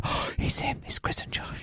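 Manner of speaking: whispered in hushed awe; awed whisper. Retold or imitated. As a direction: breathe it like starstruck fans. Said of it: "the crowd to part and go".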